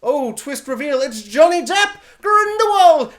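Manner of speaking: rising to a loud high announcement; mock big reveal. Mocking the film's twist reveal, building to a loud high cry. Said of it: "And it's like".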